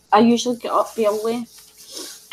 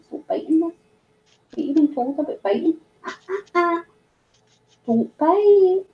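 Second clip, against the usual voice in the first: gentle scolding; 7 semitones higher than usual. Real, not acted. Gently scolding a biting pet.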